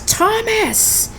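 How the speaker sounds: high-pitched yelled cry of a name; imitated woman's yell in a retelling; mock yell. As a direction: call out high, without real volume. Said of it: "And Martha yells".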